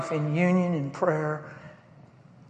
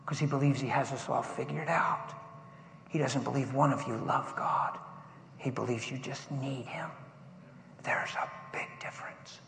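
hushed, intimate sermon delivery; quiet preaching; 3 semitones lower, 8 dB quieter than usual. Acted. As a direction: draw them in softly.

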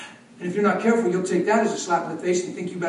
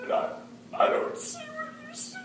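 much higher but soft, voice cracking; broken reply; 10 dB quieter than usual. Retold or imitated. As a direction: say it as your voice breaks. Father answers quietly, breaking.

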